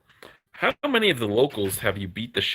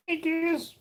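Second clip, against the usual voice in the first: talking to a dog. Real, not acted. Greeting the dog.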